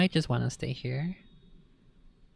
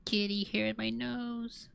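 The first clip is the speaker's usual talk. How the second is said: ordinary talk, nothing marked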